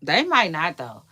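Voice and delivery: ordinary talk, nothing marked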